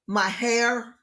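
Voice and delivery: ordinary delivery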